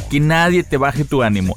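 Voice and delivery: normal talking voice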